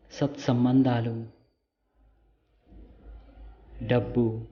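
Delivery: calm meditation guide with long pauses; guided meditation. Real, not acted. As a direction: lead them gently, phrase by phrase, leaving silences. Leads a light meditation. (నెమ్మదిగా, విరామాలతో ధ్యాన మార్గదర్శక స్వరం)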